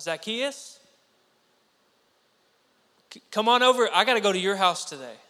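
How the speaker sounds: loud, high call up into a tree. Acted. Jesus calling Zacchaeus down from the tree, loud eager call. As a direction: shout up to him, eager and welcoming.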